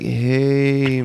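slowed-down stoner drawl; stoned half-speed voice. Retold or imitated. Host imitates their podcast at half speed, stoned drawl. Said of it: "sounds like we are stoned".

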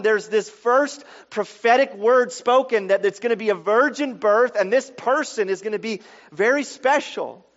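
rising into a fervent sermon peak; preaching at full force. Acted. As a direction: drive the point home to the congregation.

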